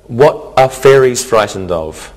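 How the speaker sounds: normal talking voice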